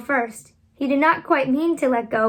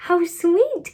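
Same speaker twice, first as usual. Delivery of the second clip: delighted cry; 6 semitones higher than usual. A narrator's reading. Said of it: "cried Wendy".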